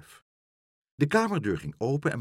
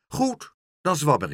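decisive; 6 semitones higher, 6 dB louder than usual. A narrator's reading. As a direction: settle the matter with no argument. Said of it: "zei mevrouw Helderder beslist".

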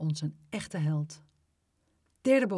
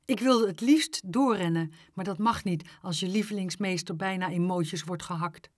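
ordinary delivery, nothing marked

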